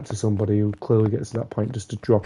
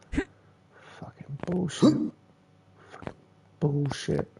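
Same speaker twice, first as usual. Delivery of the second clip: drawn-out whispered swear; slow whispered curse; 4 semitones higher, 4 dB quieter, slower than usual. Real, not acted. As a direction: whisper it slowly in disgust. Cursing the game under breath.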